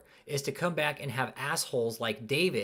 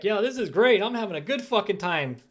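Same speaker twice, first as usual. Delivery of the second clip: higher, louder smug mock gloating; mocking David; 6 semitones higher, 7 dB louder than usual. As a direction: sneer his reply back like a gloating fool. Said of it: "David sent back".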